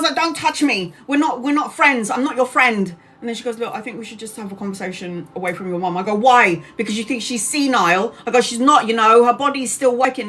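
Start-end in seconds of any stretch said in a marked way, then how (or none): 0.1-2.9 higher, forceful "don't touch me"; angry retort. Retold or imitated. Daughter to head nurse, higher angry warning. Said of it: "I was so angry". 3.6-6.0 softer, quick smoothing-over; placating nurse. Retold or imitated. Head nurse to angry daughter, softer hurried calming. 6.1-10.3 louder challenging retort; heated defence. Retold or imitated. Daughter to head nurse, louder heated defence.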